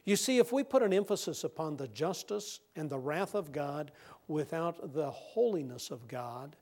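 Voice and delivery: usual speaking voice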